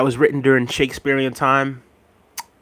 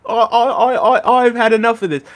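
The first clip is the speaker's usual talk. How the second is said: laughing mock catchphrase; 8 semitones higher than usual.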